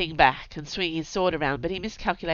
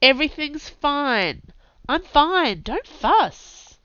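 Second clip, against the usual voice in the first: forced brightness; 9 semitones higher, 6 dB louder, slower than usual. A narrator's reading. Zed fakes cheer hiding wound.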